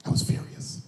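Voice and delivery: breathless whisper; mock-furious whisper; whispered aside. Real, not acted. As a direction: hiss it under the laugh. Whispered punchline.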